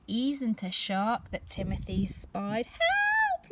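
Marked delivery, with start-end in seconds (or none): 2.7-3.5 very high, louder cry; mock cry for help. The mummy sees the runaway pram, crying help. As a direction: shriek for help in a panic.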